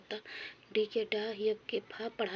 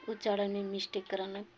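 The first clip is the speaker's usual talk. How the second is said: ordinary talk, nothing marked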